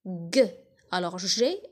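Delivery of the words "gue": G as "gue", the sound starting gâteau; hard g sound. Shown as a demonstration. Teacher picking out the sound in gâteau, hard g. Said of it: "on entend le son".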